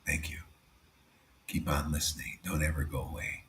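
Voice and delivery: soft whispered encouragement; preacher whispering kindly to Ranga. Real, not acted. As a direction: whisper it gently to the listener. Aside to Ranga.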